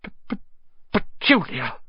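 stammered, gasped-out name; gasping. A narrator's reading. Uncle calls wife in shock, gasping stammer. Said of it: "he gasped".

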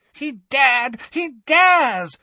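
very high, loud frantic shriek; shrieked incoherently. A narrator's reading. Bellatrix beside herself at Harry, very high loud shriek. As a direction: lose control, screeching. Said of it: "shrieked Bellatrix incoherently".

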